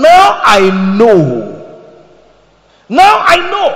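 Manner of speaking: slow, loud proclamation; preaching at full force. Acted. Preacher to congregation, booming slow proclamation. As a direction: declare it with all your power.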